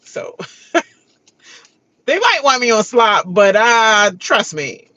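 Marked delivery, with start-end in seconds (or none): none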